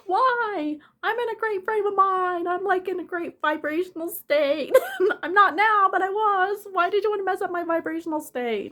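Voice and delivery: high, whiny wail with bursts of laughter; mock crying lament. Limping back to the car crying, hammed-up high wail. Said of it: "crying all the way back".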